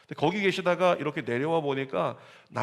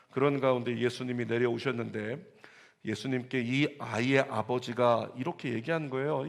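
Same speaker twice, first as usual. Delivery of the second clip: usual speaking voice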